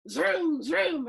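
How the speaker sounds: voiced race car revs; engine zooming. Reader leading listeners in race car noises, engine zooming. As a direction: zoom like a revving toy car. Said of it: "making his mouth make sounds".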